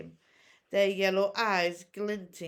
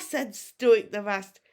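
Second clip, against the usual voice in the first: plain reading voice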